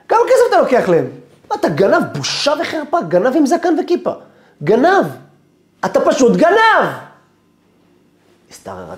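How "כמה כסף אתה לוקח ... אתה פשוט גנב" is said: loud, high-pitched public accusation; angry shouting. Retold or imitated. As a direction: denounce him at full volume. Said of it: "הוא פשוט צועק עליי". (צעקות האשמה זועמות)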